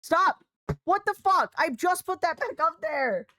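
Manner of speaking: high, loud yell at the cat, slowing on the last line; exasperated yell. Real, not acted. Streamer yelling at her cat for knocking the thing down again, high and loud. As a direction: shout in frustrated disbelief.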